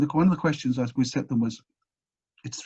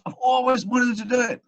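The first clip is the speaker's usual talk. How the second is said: much higher, bursting with eagerness; imitating a keen scientist, pitched way up; eager; 11 semitones higher than usual. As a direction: blurt it out like a lifelong dream come true. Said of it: "And he goes".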